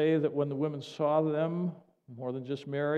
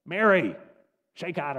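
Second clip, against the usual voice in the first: sharp rousing call; 2 semitones higher, 7 dB louder than usual. Acted. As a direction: call out to snap someone out of it. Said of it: "I try and inflect what he would say".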